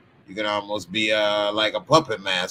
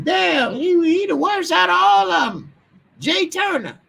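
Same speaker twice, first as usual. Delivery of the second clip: very high, loud squealed falsetto; mock outraged viewer; 6 dB louder than usual. Streamer imitating people complaining about him, squealing in mock outrage. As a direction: screech it like an exaggerated complainer.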